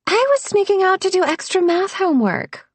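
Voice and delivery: high-voiced mock-innocent excuse; deadpan. A narrator's reading. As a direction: claim it with a straight face, pitched high.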